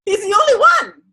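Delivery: high, loud insistence; emphatic praise. Real, not acted. Praising the actor as the only one. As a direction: insist on it loudly.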